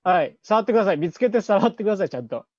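ordinary talk, nothing marked

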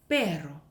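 "pero" with a trilled double r; low "pero" with a Spanish trill; rolled r. Shown as a demonstration. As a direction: roll the r in the middle of "pero". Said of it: "굴리는 발음인데".

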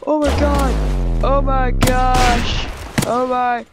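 loud, high burst of alarm easing down; loud, frantic string of oh-mys; panicked exclaiming. Real, not acted. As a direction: cry out in mounting game panic. Panicking at something in the game.

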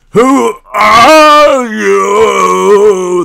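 long, drawn-out high bellow; very slow, deranged full-force shout; monstrous roar. A narrator's reading. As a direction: roar each word out, hugely stretched. Enraged guard charging at them.

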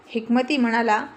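ordinary delivery, nothing marked

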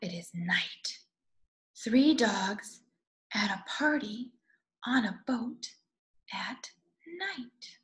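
soft, breathy-quiet night reading; whispered night lines; hushed bedtime whisper. A narrator's reading. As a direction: hush it down for night. Night scene read softly.

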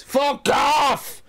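high, hammed-up angry yell; exasperated mock bellow; shouted retort. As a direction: shout back to be left alone playing. Retold shouting back at nag.

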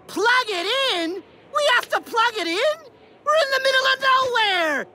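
high, incredulous complaint; exasperated. Acted. Traveler learns it needs plugging in, exasperated. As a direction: protest in mounting disbelief. Exasperated at partner's failed plan.

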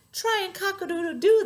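high, loud hen urging; hens' demand. A narrator's reading. Other hens egg the owl on, high bossy call. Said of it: "until the other hens said".

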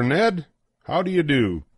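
ordinary delivery, nothing marked

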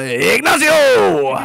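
loud, drawn-out name call; stretched, showy shout; hammed-up announcer cry. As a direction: belt the name out long and high.

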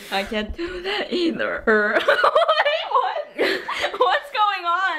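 giggling through the words; can't stop laughing while talking. Real, not acted. Giggling fit over failed trick.

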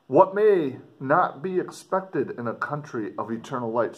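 dramatic reading. Acted. Teacher reading the explorer's letter aloud to students, expressive. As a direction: read it out to the class with feeling.

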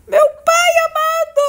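high, slow cry of dismay; dismayed shout. Real, not acted. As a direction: wail it out in dismay. Dismay at staying a chicken.

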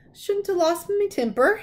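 regretful, a bit sheepish; ruefully. A narrator's reading. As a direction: giant sorry for his outburst. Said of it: "he said ruefully".

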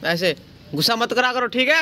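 high, coaxing voice for the monkeys; sing-song voice for a pet; coaxing pet talk. Real, not acted.

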